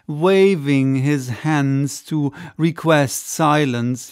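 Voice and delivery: usual speaking voice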